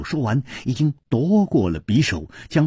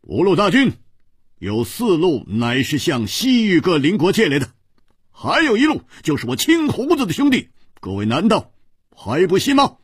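high, rousing address to the troops; raised-voice shout; 7 semitones higher than usual. A narrator's reading. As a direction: call out over the crowd, pitched high. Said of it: "扬声大喝". (扬声大喝，向三军喊话)

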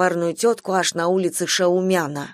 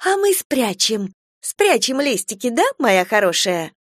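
gushing; 4 semitones higher than usual. Acted. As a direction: pour on the sweetness. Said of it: "разливается тётечка".

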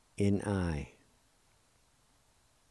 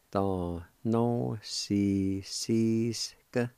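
very slow, "Da no si si ska" stretched syllable by syllable; slow demo; much slower than usual. Shown as a demonstration. Teacher repeating the Cherokee word slowly for students. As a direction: sound out each syllable carefully. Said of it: "it's pronounced like this".

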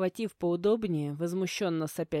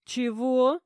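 high, loud, indignant question; outraged, pitch shooting upward; 5 semitones higher, 5 dB louder than usual. A narrator's reading. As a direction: burst out in affronted disbelief. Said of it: "возмутилась я".

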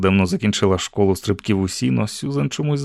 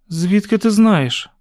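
startled question; 10 semitones higher, slower than usual. A narrator's reading. Girl shocked by father's name.

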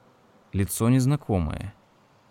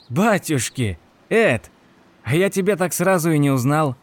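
higher, louder surprised exclamation; sudden surprise at seeing an old friend; surprised recognition; 6 semitones higher, 4 dB louder than usual. A narrator's reading. Shopkeeper suddenly recognizes Ed in surprise.

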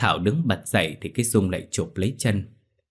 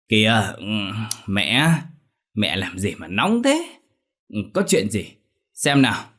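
man's drawling voice. A narrator's reading. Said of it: "giọng lẻ nhẹ của một người đàn ông".

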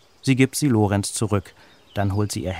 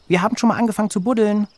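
much higher, loud and fast; excited shout; 12 semitones higher, 6 dB louder, much faster than usual. A narrator's reading. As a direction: blurt it out eagerly in a rush. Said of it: "schreien Lisa und Benny". (laut, hoch und schnell aufgeregt rufen)

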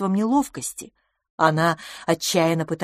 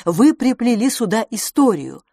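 ordinary delivery

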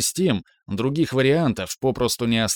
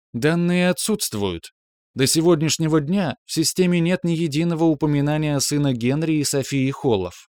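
higher, clipped monotone computer; monotone machine; 5 semitones higher than usual. Acted. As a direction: rap it out like a machine. System answer.

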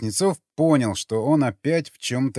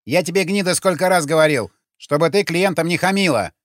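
raised, higher angry telling-off; heated, high-pitched reprimand; exclaimed scolding; 5 semitones higher, 5 dB louder than usual. A narrator's reading. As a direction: scold her loudly as her angry pimp. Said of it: "воскликнул он".

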